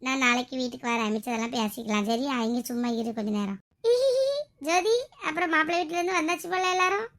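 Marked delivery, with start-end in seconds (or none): 3.8-4.6 a short high giggle; high laugh. Acted. Friend laughing at the mother's scolding, high giggle. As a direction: burst into a quick high laugh.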